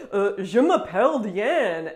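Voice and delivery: put-on slow, loud parody of a foreign accent in French; mocking her accent in French, slowly and loudly; mocking imitation of her accent. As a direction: ham up her accent to mock it. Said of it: "a French person will say".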